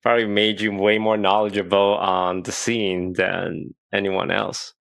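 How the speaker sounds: ordinary talk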